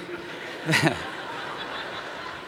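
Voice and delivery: quiet unvoiced chuckle; low airy laugh; soft breathy laugh. Real, not acted. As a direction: let a hushed laugh slip out. Laughs after his story.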